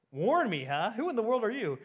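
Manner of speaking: loud, high, scoffing questions; storyteller acting an indignant burglar; incredulous challenge. A narrator's reading. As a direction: throw it back at the bird in disbelief. Burglar challenging the talking parrot.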